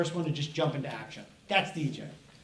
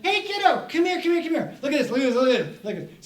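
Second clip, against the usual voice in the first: high, loud, urgent repeated calls; excited summons; 8 semitones higher, 6 dB louder than usual. Acted. Author pulling kid over to show new cat, excited. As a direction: beckon someone over in a rush of excitement. Acts out excited caller at door.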